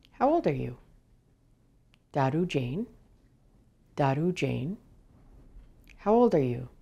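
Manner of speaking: ordinary talk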